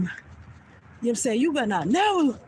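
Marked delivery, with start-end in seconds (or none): 1.4-2.4 enraged threat. Retold or imitated. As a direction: spit the threat out in a rage. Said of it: "I am in a rage".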